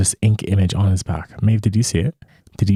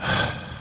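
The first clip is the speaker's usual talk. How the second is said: dramatic sigh; 9 dB quieter than usual. Real, not acted. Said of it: "That's a big sigh".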